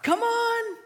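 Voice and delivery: put-on high, sing-song coax to a toddler; coaxing parent. Parent coaxing a toddler through first steps, high sing-song.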